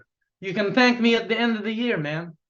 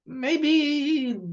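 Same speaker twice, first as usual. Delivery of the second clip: mock hesitant tease; 7 semitones higher than usual. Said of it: "I'm just kidding".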